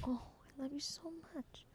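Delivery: tender whisper to a pet. Real, not acted. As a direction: whisper it lovingly to the dog.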